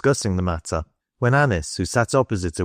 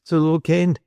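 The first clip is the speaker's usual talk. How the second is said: put-on higher-pitched, mildly nervous boy; tremulous; 8 semitones higher than usual. A narrator's reading. Caught boy explains why he came, tremulous. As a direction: offer it timidly, as a lightly voiced boy. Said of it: "he said tremulously".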